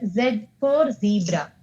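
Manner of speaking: Z said "zed" before "zebra"; slow, with Z pronounced "zed"; British zed. Shown as a demonstration. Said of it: "Z is the accent which we".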